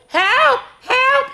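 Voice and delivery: very high, urgent cry for help; a puppy calling up from the hole. A narrator's reading. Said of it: "he cried".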